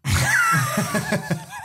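high wheezing burst of laughter; shrieking laugh. Real, not acted. Laughing at the awkward airport fan, high squealing laugh. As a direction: crack up in a squeal.

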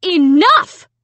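loud, high one-word shout. A narrator's reading. Mom stopping her quarreling children, shouting. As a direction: cut them off with a shout. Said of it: "Mom shouted".

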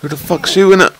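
furious shout at the skeleton; angry yell. Real, not acted. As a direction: rage at the unseen archer. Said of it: "he's very angry".

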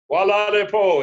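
loud, high, called-out greeting; confident greeting. Retold or imitated. Greeting the first person at the airport, confidently calling. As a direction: call it out proudly, stretching the ending.

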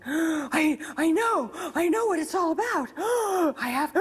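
high, urgent words broken by gasps; imitated feverish insistence, gasping; intense gasping. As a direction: act out a frantic, breathless revelation. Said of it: "started this kind of intense".

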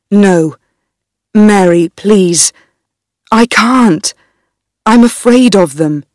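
a hint of a scared young husband, pleading; lightly voiced frightened husband begging his wife. Darnley refuses in fear.

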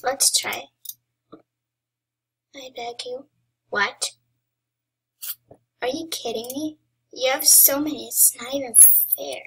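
normal talking voice

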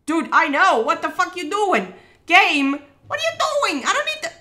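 loud protest climbing very high; exasperated outburst. Real, not acted. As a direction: yell at the game, pitch shooting up.

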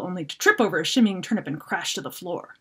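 plain reading voice

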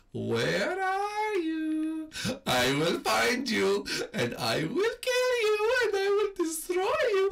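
slow, high, drawn-out killer threat; mock villain taunt. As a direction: stretch each word like a cartoon villain. Menacing murderer taunt.

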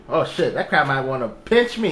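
normal talking voice

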